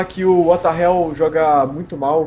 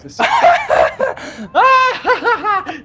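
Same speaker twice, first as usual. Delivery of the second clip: high shrieking burst of laughter; loud shrieking laugh; 4 dB louder than usual. Acted. Mocking the laugh bit.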